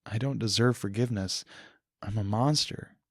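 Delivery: ordinary delivery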